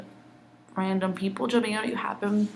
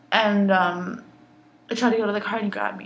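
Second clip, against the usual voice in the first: ordinary talk, nothing marked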